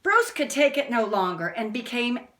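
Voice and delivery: ordinary delivery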